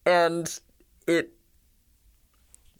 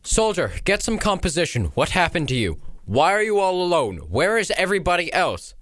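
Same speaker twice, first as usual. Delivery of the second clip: high, urgent military demand; insistent soldier taking command; stern questioning; 12 semitones higher than usual. Acted. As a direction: demand answers from a broken soldier. Officer-like questioning of sobbing survivor.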